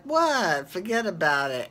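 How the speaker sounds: put-on high "What?" in a New York Italian accent; a light New York Italian shrug; hammed-up New York Italian diner; mock New York Italian. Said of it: "They're like".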